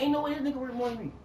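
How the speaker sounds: fast, loud, high-pitched rant; heated rant. Retold or imitated. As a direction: rattle it off like you can't believe it. Retelling his own rant.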